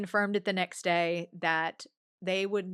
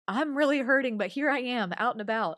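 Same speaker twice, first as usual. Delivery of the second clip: higher, louder heartfelt declaration; emphatic inner cry; 7 semitones higher, 6 dB louder than usual. Retold or imitated. Grieving mother wanting everyone to know, higher and louder. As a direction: proclaim the pain to everyone. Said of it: "I wanted everyone to know".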